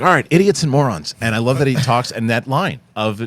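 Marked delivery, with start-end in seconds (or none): 0.0-1.1 mock greeting. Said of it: "he's like".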